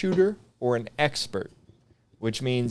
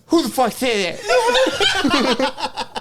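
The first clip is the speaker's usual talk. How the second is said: slow, high, laughing angry sergeant roar; furious drill sergeant; 5 dB louder, much slower than usual. Retold or imitated. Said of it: "that I could impersonate pretty well".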